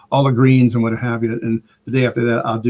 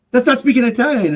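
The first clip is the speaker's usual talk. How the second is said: high-pitched retold objection; mock protest; 11 semitones higher than usual. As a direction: imitate a comic's exasperated correction. Retold comedy bit, buddy objects.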